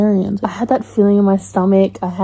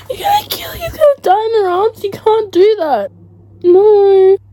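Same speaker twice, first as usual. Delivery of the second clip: high, tearful protest; real crying, pitched well up; 8 semitones higher than usual. Real, not acted. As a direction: sob out your refusal to accept the death. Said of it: "I'm crying like I know this dude".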